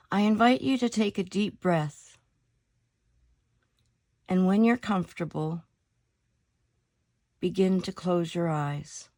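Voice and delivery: calm meditation voice with long pauses between phrases; guided meditation. Acted. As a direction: lead them unhurriedly into stillness, letting each phrase settle.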